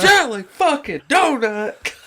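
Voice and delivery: slow, high-pitched sergeant holler; drill sergeant. Acted. Echoing the co-host's movie quote, drill sergeant. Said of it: "fucking Full Metal Jacket".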